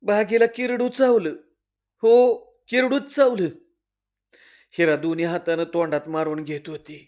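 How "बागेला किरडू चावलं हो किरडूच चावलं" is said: alarmed cry. Acted. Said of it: "Shevanta मावशी म्हणली".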